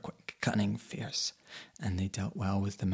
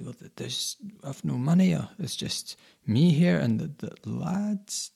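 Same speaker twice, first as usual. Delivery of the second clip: higher, stammering panic; frightened stammer; 6 semitones higher, 5 dB louder than usual. A narrator's reading. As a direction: stammer it out in fear, voice climbing. Narrator voicing his frightened self.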